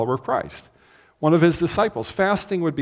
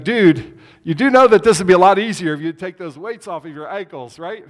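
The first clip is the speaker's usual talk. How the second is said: teasing call out; 3 semitones higher, 9 dB louder than usual. Pretend. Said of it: "roll down the window and say".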